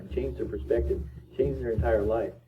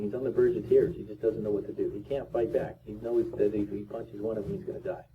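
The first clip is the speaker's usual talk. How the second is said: ordinary delivery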